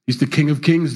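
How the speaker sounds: ordinary talk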